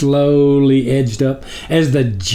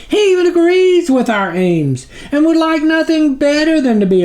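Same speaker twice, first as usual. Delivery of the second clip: groveling minion; 10 semitones higher than usual. A narrator's reading. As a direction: plead upward to your dark master. Snuffer lists failed attempts.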